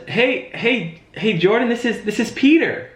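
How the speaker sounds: high, bright-pitched greeting with a falling end on Peter; familiar friendly greeting. Shown as a demonstration. Sales trainer demonstrating a familiar phone intro, high and upbeat. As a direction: greet him like a long-lost best friend. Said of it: "So, ring, ring, hello, who's this?".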